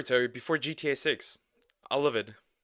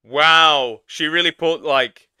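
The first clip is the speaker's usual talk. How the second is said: excited; 10 dB louder, slower than usual. Real, not acted. Laughing reaction to the scene.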